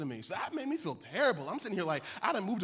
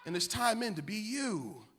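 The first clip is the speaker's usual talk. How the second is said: high, shouted, drawn-out sermon climax; full-force preaching; 7 semitones higher, 13 dB louder, slower than usual. Real, not acted. Preacher urging Vallejo to use their voice, full-force preaching. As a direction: drive each phrase home at the top of your voice.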